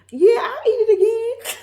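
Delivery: high, loud squeaky assurance; hammed-up high-pitched reassurance; strained approval. Voicing woman's reluctant breakfast verdict.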